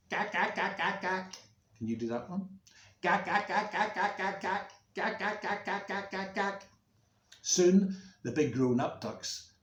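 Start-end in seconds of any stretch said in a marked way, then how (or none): 0.1-1.3 high, quick little quacks; tiny duckling chattering in an even string; baby duck. Acted. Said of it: "a little baby duck sound like this". 3.0-6.6 high-pitched rapid duckling quacks; baby duck. Shown as a demonstration. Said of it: "a little baby duck sound".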